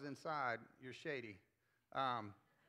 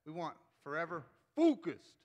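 big, loud, forceful FOCUSED; intense focused; 3 semitones higher, 9 dB louder than usual. Shown as a demonstration. Pastor shows the congregation a bold, forceful focused. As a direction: punch focused out like flexing your abs. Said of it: "you don't say that focused".

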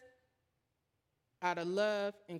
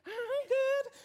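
high, slow laughing I'm good; squeaky drawn-out laughing denial; 5 dB louder than usual. Retold or imitated. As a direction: insist you're fine through a laugh. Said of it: "I had this feeling my spirit ain't gonna be good".

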